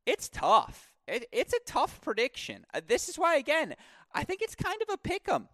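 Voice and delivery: agonized indecision. Real, not acted. Agonizing over a tough prediction.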